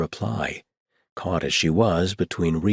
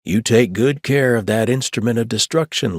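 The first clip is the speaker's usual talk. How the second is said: put-on Old West accent; 6 semitones higher, 7 dB louder than usual. A narrator's reading. Chuck handing Carmelita the hatchet, mock-serious Old West accent. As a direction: play the grave cowboy lawman. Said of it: "in an Old West accent".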